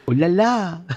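mock French "Hola, la" with a falling "la"; hammed-up French greeting; French accent. Gamer greeting his friend in mock French accent. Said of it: "¿cómo que te sientes francés?".

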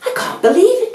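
very high chirpy bird voice; tweeting birds. A narrator's reading. Birds watching the finish, very high chirpy voice. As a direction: chirp it in amazement. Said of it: "tweeted the birds".

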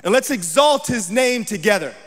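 preaching scripture. A narrator's reading. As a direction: proclaim the psalm at full force. Declaims the psalm.

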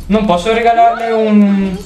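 ordinary talk

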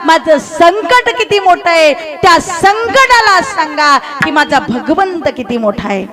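high, ringing exhortation to the congregation; fervent preaching. Acted. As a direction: urge them on with stretched, emphatic key words. Exhorts congregation at full force.

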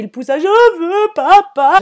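put-on high, loud, slow whining child voice; imitating a scared toddler; scared little girl. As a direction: whine it like a frightened toddler. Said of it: "elle fait".

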